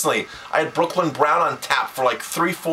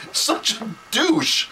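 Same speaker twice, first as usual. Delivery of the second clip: slow, high, scornful put-down; a higher, dragged-out sneer; disgusted jab; 9 semitones higher, much slower than usual. Real, not acted. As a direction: drag it out with contempt. Ranting about the absent rep.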